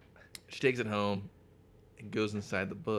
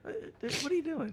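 squeaky put-on voice, faster; high squeaky mock outrage; faster than usual. Squeaky mock outrage at a friend derailing the story.

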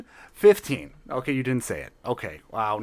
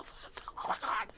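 whispering; 13 dB quieter, much slower than usual. Acted. Awed whisper.

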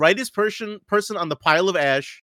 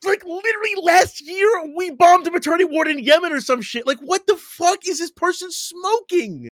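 high, indignant rant; outraged disbelief; 10 semitones higher than usual. Real, not acted. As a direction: scoff at the article in disbelief. Outraged at the article.

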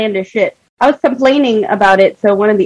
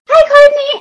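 high, louder sing-song hello across the room; eager called greeting; 5 dB louder, slower than usual. Retold or imitated. Creepy coworker greeting her as she walks by, eager high call. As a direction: call her name out overeagerly.

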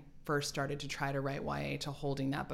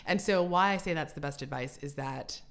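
ordinary talk, nothing marked

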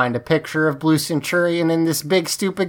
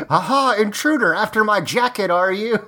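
higher, theatrical gotcha; hammed-up accusation; 5 semitones higher than usual. Blue Centurion catching Ashley near his jacket, hammed-up accusation. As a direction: pounce on the intruder in mock outrage. Mock villain accusation, host laughs after.

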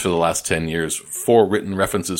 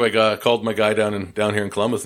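ordinary delivery

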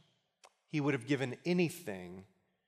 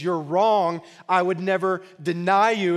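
louder, higher retelling of an indignant denial; emphatic protest; 5 semitones higher, 10 dB louder than usual. Retold or imitated. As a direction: protest loudly, acting out the disciple. Peter hotly contradicts Jesus.

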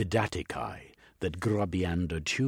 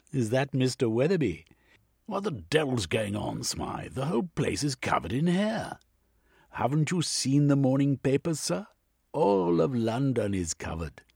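louder, higher proper butler; 8 semitones higher, 5 dB louder than usual. Acted. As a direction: play the dutiful butler. Butler Smythe on telephone.

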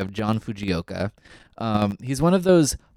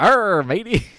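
hammed-up pirate growl, higher and louder; bad pantomime pirate; 9 semitones higher, 6 dB louder, slower than usual. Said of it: "does this really crappy, like, pirate character".

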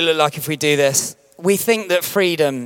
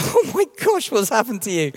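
shocked wife; 7 semitones higher than usual. Retold or imitated. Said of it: "And she's like".

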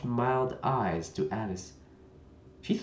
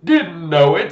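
vexed; 9 semitones higher, 9 dB louder, much slower than usual. Acted. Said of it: "a shade of vexation".

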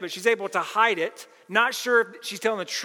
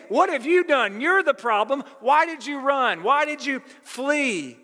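gaslighting accusation; 8 semitones higher, 5 dB louder than usual. Retold or imitated. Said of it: "Laban is absolutely gaslighting Jacob".

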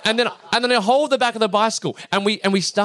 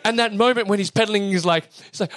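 usual speaking voice

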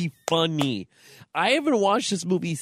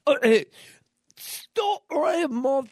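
much higher, squeaky, bad British accent; 9 semitones higher than usual. Host tries Bridget Jones's diary line, in a squeaky mock British accent. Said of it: "She needs a British accent".

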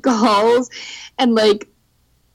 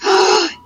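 surprised gasp; 10 semitones higher than usual. Real, not acted. Surprised by news.

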